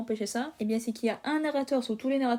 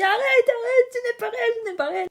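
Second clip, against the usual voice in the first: much higher, loud panicked cry; panicked plea; 11 semitones higher, 7 dB louder than usual. Acted. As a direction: beg it to stop, in a high panic. Frankie panics at illusion.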